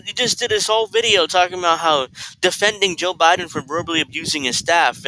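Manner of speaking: ordinary talk, nothing marked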